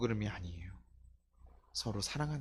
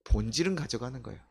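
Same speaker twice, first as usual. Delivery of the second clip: ordinary delivery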